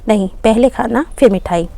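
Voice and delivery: plain reading voice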